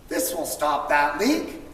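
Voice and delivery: plain reading voice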